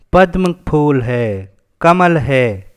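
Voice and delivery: ordinary delivery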